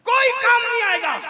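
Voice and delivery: high, loud cry; shouted warning. Real, not acted. Preacher warning nobody will help them, high loud cry. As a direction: burst out with the warning.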